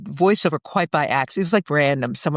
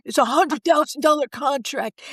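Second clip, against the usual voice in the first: higher, lightly voiced old-school agent hustle; moth-eaten agent; 5 semitones higher than usual. Agent on the phone pushing an audition, mock hustle. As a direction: pitch it like a weary talent agent. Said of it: "kinda talk like this".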